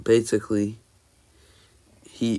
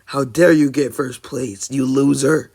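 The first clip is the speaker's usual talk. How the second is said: much higher, louder furious yell; angry Bakugo shout; 3 semitones higher, 8 dB louder than usual. Acted. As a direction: bark it at a rival you despise. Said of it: "He says".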